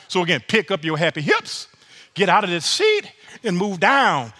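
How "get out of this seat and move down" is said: ordinary talk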